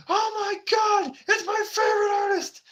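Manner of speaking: squealing high-pitched fan gushing; excited squeal of a fan spotting her idol; fangirl squeal. Retold or imitated. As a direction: shriek with delight like a starstruck fan. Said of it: "you see her going".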